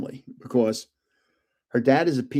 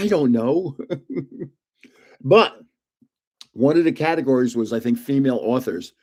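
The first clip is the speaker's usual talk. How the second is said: normal talking voice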